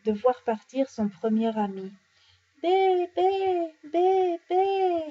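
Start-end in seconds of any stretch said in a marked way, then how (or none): 2.6-5.0 high, long lamb cries; sad bleating lamb. A narrator's reading. The lamb watches its first friend leave, plaintive bleating. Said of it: "les cris déchirants de l'agneau attristé".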